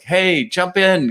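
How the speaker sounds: lazy mock call from a houseboat.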